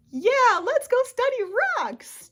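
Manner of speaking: high, loud excited shout on the first words; mock enthusiasm. Speaker acts out eagerness to go study rocks, mock enthusiasm. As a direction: whoop it out like a keen student.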